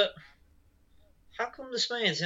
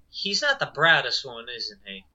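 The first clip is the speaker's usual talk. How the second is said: lower, trailing-off mock aside; a touch of deadpan; dry Derek; 5 semitones lower than usual. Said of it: "Derek just says".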